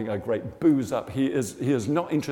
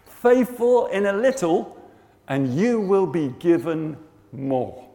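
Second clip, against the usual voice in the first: slow, raised, loud proclamation; voice of God; 5 semitones higher, 5 dB louder, slower than usual. Retold or imitated. God giving the second verse to Rog, slow proclamation. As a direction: proclaim it word by word, drawn out. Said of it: "the second thing he says".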